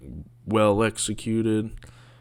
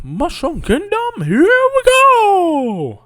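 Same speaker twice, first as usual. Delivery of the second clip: much higher, slow, loud cartoon Mario voice; bad Mario impression; 11 dB louder, slower than usual. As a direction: ham up a goofy Mario. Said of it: "I don't really do a good Mario impression".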